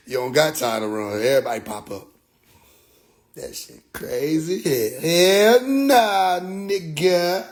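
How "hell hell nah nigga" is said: slow stretched hell nah, higher; drawn-out hell nah. Real, not acted. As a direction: drag out the refusal. Dismissive reaction.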